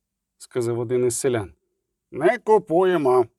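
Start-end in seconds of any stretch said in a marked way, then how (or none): 2.1-3.3 growled refusal. A narrator's reading. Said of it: "гарикнули в унісон Золтан і Шутенбах".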